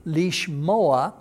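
lishmoa as leesh-MO-a; Hebrew pronunciation. Shown as a demonstration. Teacher repeating the Hebrew verb, Hebrew pronunciation. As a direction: say lishmoa with stress on MO. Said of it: "a Hebrew word in Hebrew".

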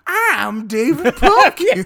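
David Pumpkins catchphrase shout. Acted. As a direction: belt out the catchphrase slowly. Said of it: "you went for that rather than".

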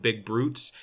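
normal talking voice

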